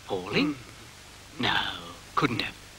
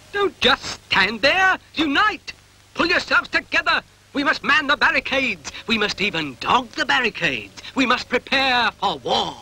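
rousing speech; 8 semitones higher, 4 dB louder than usual. Acted. Dog's rallying war speech.

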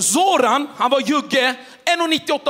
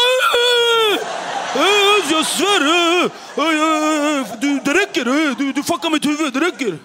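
furious tough-guy threat; 2 semitones higher, slower than usual. Retold or imitated. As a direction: blow up like a hothead bully. Zoran losing it again.

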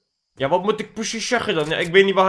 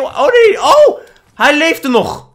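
high, loud cry of dismay; a sudden loud wail at the screen; dismayed shout; 11 semitones higher, 10 dB louder, much slower than usual. Real, not acted. Gamer shouting as a teammate takes his kill.

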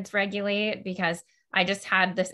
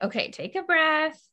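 mock parent coaxing, higher; trying-hard parent; 8 semitones higher than usual. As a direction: imitate a parent working hard to settle the child. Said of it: "parents in the clinic even who'll be like".

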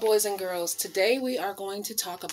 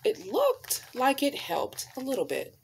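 expressive picture-book storytelling voice; reading to children; 4 dB louder, slower than usual. Acted. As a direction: read it like storytime on the rug.

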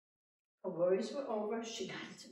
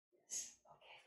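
soft whispered aside; 12 dB quieter than usual. Real, not acted. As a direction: breathe it under your breath. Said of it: "whispered aside".

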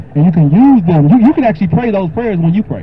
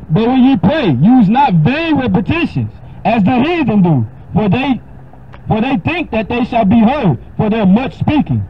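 raised, solemn King James reading; scripture reading; 6 semitones higher than usual. Acted. Preacher reading Matthew six to a caller, solemn. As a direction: read it like holy writ.

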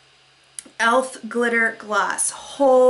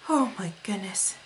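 awed whisper; 2 semitones lower, 7 dB quieter than usual. Real, not acted.

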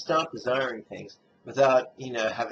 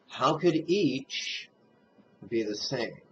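slow, raised opening, then a pause; contemplative question; 2 semitones higher, slower than usual. Shown as a demonstration. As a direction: pose it slowly as a question to ponder. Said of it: "Second rhetorical contemplative question".

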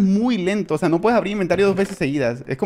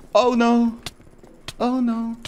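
mock dismay; 8 semitones higher, much slower than usual. Gamer in danger without armour, high mock dismay. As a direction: repeat the lament high, trailing away. Repeats lament as danger nears.

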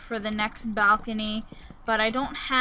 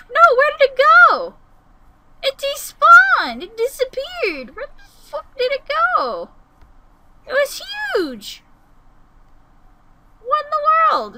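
very high, loud cries of disbelief; dismayed shouting; 12 dB louder than usual. Real, not acted. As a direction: shriek it as the thing vanishes before you. Huge creature suddenly vanished.

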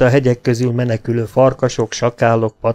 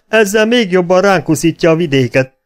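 high, raised-voice complaint; alarmed shout; 9 semitones higher, 6 dB louder than usual. A narrator's reading. Robber railing at his gang's bad news, shouted. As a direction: call it out loud and high. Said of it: "kiáltotta Harry".

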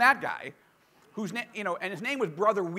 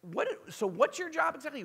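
mock puzzled; 9 semitones higher, 5 dB quieter than usual. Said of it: "I'm like".

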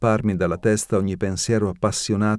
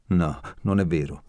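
usual speaking voice

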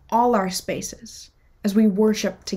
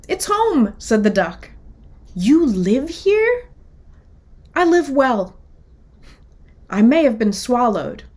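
lively picture-book read-aloud, characters voiced; children's story reading; 5 dB louder than usual. A narrator's reading. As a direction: tell it like storytime for kids.

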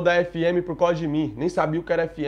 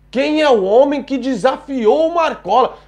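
higher, louder, slow, hammed-up news-anchor line; slow, higher, louder mock TV reporter voice, making the boss sound like a god; mock dramatic reporter; 7 semitones higher, 6 dB louder, slower than usual. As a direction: ham up a sensational TV reporter. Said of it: "do jeito que esses repórteres falam dele".

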